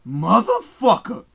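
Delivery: quoting the movie line. Retold or imitated. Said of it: "that line".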